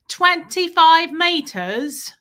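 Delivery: put-on drawn-out British little-kid accent. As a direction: mimic a British child, stretching each word. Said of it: "with an accent".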